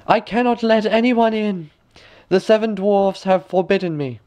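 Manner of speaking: put-on high girlish voice; high young girl refusing. A narrator's reading. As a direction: politely refuse like a young princess.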